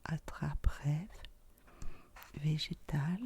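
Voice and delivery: ordinary delivery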